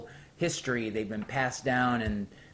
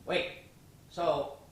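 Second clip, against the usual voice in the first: ordinary talk, nothing marked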